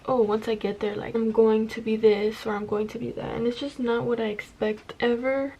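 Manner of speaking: ordinary talk, nothing marked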